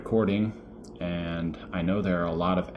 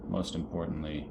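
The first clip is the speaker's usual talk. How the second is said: "most importantly" said again much softer; a quiet, lower repeat of "most importantly"; 3 semitones lower, 8 dB quieter than usual. Real, not acted. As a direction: drop your voice on "most importantly".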